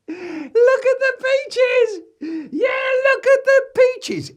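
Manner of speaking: very high, loud, drawn-out squeal; piercing kid's cry; shrieking child. Acted. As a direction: yell it like an overexcited little boy. Small boy walks round crowing.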